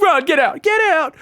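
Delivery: high, loud screeching panic; screeching friend fleeing after throwing an egg; panicked mock shout. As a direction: yell at the driver to floor it. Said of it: "You're like".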